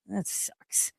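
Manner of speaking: barely voiced, breathy mutter; mumbled. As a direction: mutter it under your breath, unimpressed. Son's deadpan reaction, retold.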